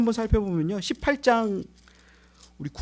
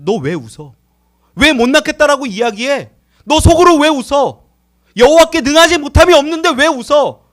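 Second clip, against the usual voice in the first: loud, higher rebuke, why are you laughing; God rebuking Sarah; 5 semitones higher, 9 dB louder than usual. Acted. God scolding Sarah for laughing secretly, loud rebuke. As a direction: scold her sharply like an offended God. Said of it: "하나님이 그 가운데에서 말씀하십니다".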